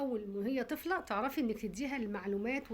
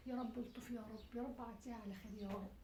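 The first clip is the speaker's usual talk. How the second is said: softer, murmured plea to God; soft desperate plea; hushed prayer; 12 dB quieter than usual. Acted. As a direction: pray quietly in fear. Mother prays again.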